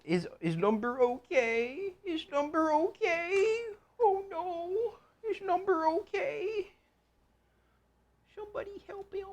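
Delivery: very high, slow, sobbing falsetto of a worried viewer; hammed-up high crying voice for the audience; mock worried fan. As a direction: wail it like an overdramatic fan. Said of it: "Now y'all gotta say".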